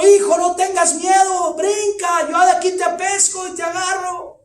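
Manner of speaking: high, loud urgent shout; father keeps shouting. Retold or imitated. Father shouts to his son to jump, urgent. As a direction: keep yelling up, insisting he'll catch him. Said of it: "el padre continuaba gritando".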